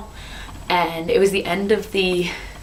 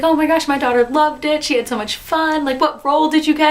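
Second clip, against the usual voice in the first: much higher and louder, eager; a proud mother chatting about her daughter; gushing mom; 10 semitones higher, 4 dB louder than usual. Retold or imitated.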